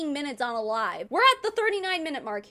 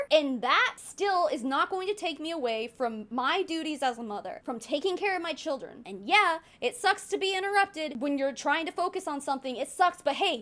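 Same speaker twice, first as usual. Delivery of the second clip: ordinary talk, nothing marked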